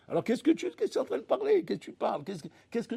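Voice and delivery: what are you talking about, incredulous; mock indignant God.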